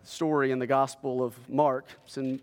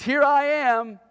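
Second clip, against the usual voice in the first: much higher, louder reply; eager answer; 11 semitones higher, 5 dB louder, slower than usual. A narrator's reading. Preacher voicing Moses answering the call, high and eager.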